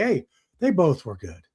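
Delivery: ordinary talk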